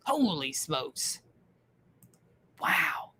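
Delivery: breathless whisper of awe; awed whisper; whispered wow. Real, not acted. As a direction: barely whisper it in amazement. Awed reaction to a penny find.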